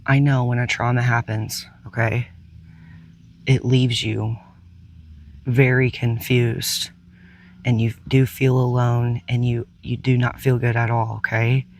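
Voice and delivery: calm, unhurried meditation guide with long pauses; soothing guide through breath and release; guided meditation. Real, not acted.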